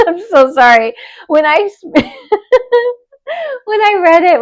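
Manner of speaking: high-pitched words broken by giggles; restrained laughter. Real, not acted. Host apologising to the listener who wrote in, restrained laughter. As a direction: apologise while holding back a real laugh. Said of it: "I laughed harder than I'm laughing now".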